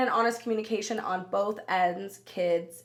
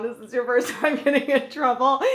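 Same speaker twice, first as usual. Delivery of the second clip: laughing through the line, a touch higher; 5 semitones higher, 5 dB louder than usual. Retold or imitated. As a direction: giggle while teasing your crying friends. Cheerfully consoling crying friends.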